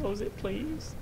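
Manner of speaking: small, high, soft begging; timid plea. Real, not acted. Scared player begs for the door closed, timid plea. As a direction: plead softly, pitch up high. Scared, pleading after shouting.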